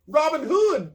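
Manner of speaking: very high, loud cry of alarm. A narrator's reading. Sheriff crying out as the outlaws unmask, high and loud. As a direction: yelp the name in shock. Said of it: "cries the Sheriff".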